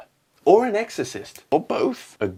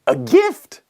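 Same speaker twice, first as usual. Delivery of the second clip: awed; 6 dB louder than usual. Acted. Judge marvelling.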